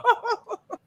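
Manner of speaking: short high laugh; high giggle. Real, not acted. Laughs at own ice.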